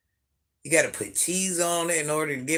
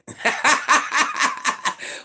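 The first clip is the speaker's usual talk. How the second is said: breathless high laughter; laughing at a cheeky chat comment; airy high laugh; 10 semitones higher, 5 dB louder than usual. Real, not acted.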